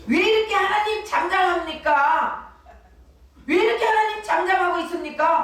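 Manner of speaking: 높은 목소리로 하나님께 따지듯; 서운함을 터뜨리는 투정 섞인 기도; 원망하는 기도 흉내. Retold or imitated. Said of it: "하면서 원망하잖아요". (문제가 생긴 신자가 하나님께 원망하듯 높게)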